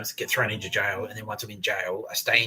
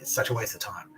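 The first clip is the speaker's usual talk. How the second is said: plain reading voice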